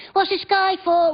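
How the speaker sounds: put-on high child's voice asking "What's this guy for?"; child's question. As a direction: pipe up like a curious little kid. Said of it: "asking questions like".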